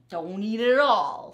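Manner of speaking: loud, snappy warning; loud snappy rat; snarl. Acted. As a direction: snarl it over the sandwich. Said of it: "snarled Rat".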